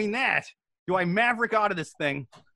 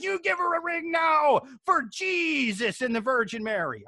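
high, slow, hammed-up maternal rage; 5 semitones higher than usual. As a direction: draw out the mock outrage, high-pitched.

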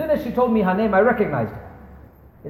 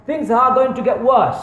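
ordinary talk, nothing marked

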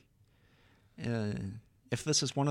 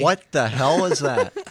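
loud, incredulous outburst; loud disbelief; 3 semitones higher, 10 dB louder than usual. Real, not acted. Friend reacting to a bad impression, loud disbelief. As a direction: blurt it out, appalled.

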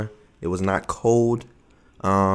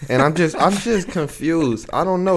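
confused exasperation; 8 semitones higher than usual. Real, not acted. Exasperated about album.

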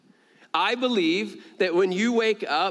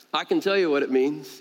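usual speaking voice

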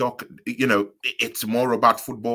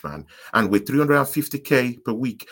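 ordinary talk

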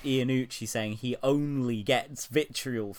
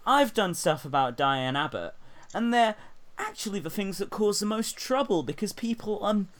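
mock quote in a higher-pitched voice; Jan Ravens; 7 semitones higher than usual. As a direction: read it out high, imitating an aggrieved impressionist. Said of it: "almost verbatim quote from Jan Ravens".

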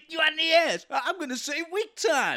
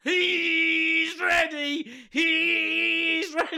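drawn-out hype; 3 semitones higher, much slower than usual. Pretend. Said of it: "your hype begins now".

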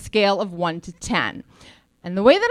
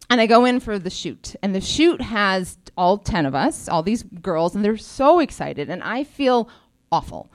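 usual speaking voice

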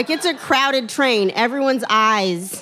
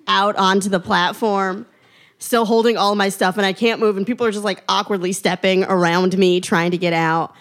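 ordinary talk

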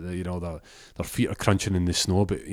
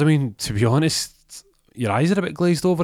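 higher-pitched, lively challenge to a friend; concerned challenge; 8 semitones higher, 4 dB louder than usual. Acted. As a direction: pitch it up, gently calling him out. Friend challenges him about drinking.

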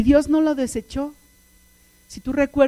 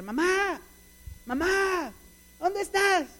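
put-on teen calling through the house, pitched up; calling for Mom; 7 semitones higher, 5 dB quieter, slower than usual. Son looking for his mother at home, high sing-out call. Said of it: "y empieza a gritar".

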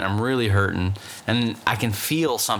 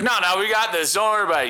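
cocky gang voice; 8 semitones higher, faster than usual. Retold or imitated. Personified pus cousins talking.

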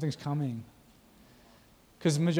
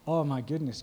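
ordinary talk, nothing marked

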